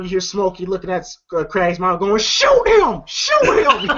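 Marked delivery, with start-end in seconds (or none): 2.2-3.9 very high imitated cry, breaking into laughter; mock frantic urging. Smokey urging Craig's mom in a fight, mock frantic cry. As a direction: urge it in a high, hammed-up voice.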